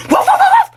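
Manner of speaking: very high mock barking; dog bark imitation. Baby sarcastically offering to bark for mama, mock barking. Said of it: "Gusto mo tumahol ako?".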